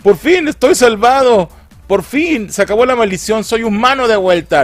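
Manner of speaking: high, loud mock jubilation; hammed-up relief. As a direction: cry out in exaggerated joy at being saved. Acts out character's relief at cure.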